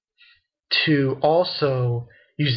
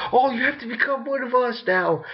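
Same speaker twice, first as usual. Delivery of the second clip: gushing gang member; 10 semitones higher than usual. Retold or imitated. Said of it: "that they say".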